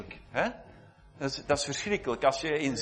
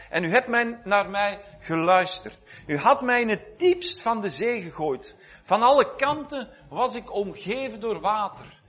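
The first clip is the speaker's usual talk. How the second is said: lifted, solemn reading of the prayer; scripture reading; 3 semitones higher than usual. Acted. Preacher reads Jonah's prayer from the sea, solemn reading. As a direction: give the psalm weight, slowing on the waves.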